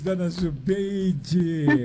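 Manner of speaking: nome bem esticado, em aclamação; aclamação festiva. Real, not acted. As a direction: aclame a cantora alongando cada palavra. Locutor festeja o fim da cantoria. (aclamando, com as sílabas esticadas)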